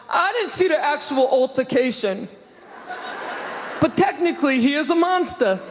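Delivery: a lightly voiced New York guy on the news; mock New York bystander. New York news interviewee, lightly voiced. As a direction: play the guy on TV, slightly put-on. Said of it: "a guy in a wife beater ... going".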